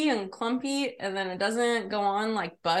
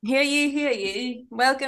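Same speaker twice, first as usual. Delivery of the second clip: town crier; 3 semitones higher, 5 dB louder than usual. Acted. Mock proclamation opening podcast.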